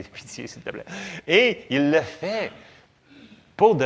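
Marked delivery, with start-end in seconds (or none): 0.0-0.8 whispered plea. Acted. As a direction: whisper "pitié, s'il te plaît" softly. Desperate prayer to God.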